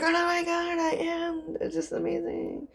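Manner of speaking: very high squeaky gush; hammed-up starstruck squeal; mock gushing. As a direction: overplay your delight in a high, thin voice. Acted gushing reply to a fan.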